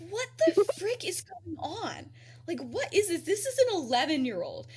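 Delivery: high, loud, incredulous retelling; baffled outburst. Retold or imitated. Professor baffled by an unknown eleven-year-old, incredulous outburst.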